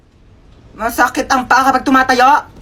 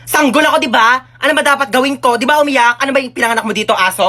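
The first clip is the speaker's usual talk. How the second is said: normal talking voice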